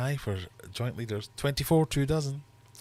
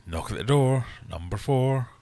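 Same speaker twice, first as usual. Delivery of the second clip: bingo caller; 3 semitones higher, 6 dB louder than usual. Acted. Bingo rhyme call.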